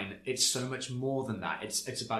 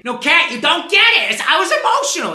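much higher, much louder pleading; mock frantic protest; 17 dB louder than usual. Mimicking Teresa insisting to Kathy she was emotional, in a loud high voice. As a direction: plead at full volume like a hammed-up housewife.

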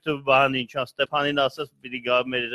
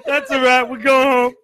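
laughing; 10 semitones higher, 8 dB louder than usual. Real, not acted. Echoing joking sign-off.

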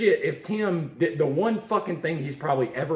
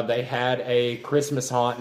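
usual speaking voice

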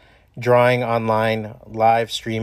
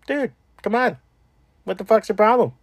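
confronting them; 9 semitones higher than usual. Retold or imitated. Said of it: "you gotta go".

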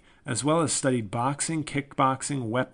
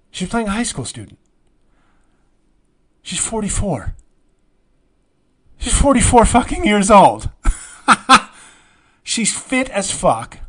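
higher, louder, laughing disbelief; incredulous; 9 semitones higher, 11 dB louder than usual. Real, not acted. Vlogger ranting to viewers about the actress playing a teenager, incredulous. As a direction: hammer home how absurd it is.

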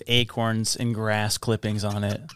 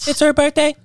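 excited; 13 semitones higher, 4 dB louder than usual. Pretend. Hyping the birthday.

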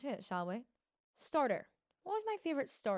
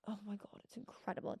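breathless, much softer whisper; awed whisper; 10 dB quieter than usual. Real, not acted. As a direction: hush it as if the taste is too good to say aloud. Whispering over a favourite dish.

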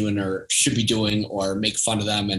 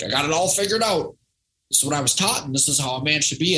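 higher, mock-confident know-it-all guy; know-it-all man; 5 semitones higher than usual. Podcast guest mocking men's certainty with a higher, cocky voice. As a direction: lightly send up a man sure he has life figured out. Voicing men who think they know.

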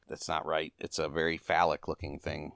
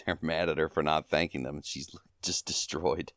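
ordinary talk

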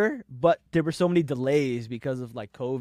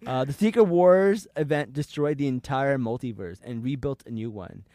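normal talking voice

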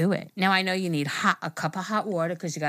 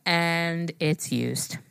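very slow, lower letdown; deadpan reveal; 3 semitones lower, much slower than usual. Retold or imitated. Telling a friend what was in the package, slow deadpan reveal. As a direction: drag out the bad news word by word.

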